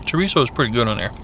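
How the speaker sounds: chewing while talking; speaking between bites; mouth full of food. Real, not acted. Seen 2: bites at -5, closed moving mouth through early words.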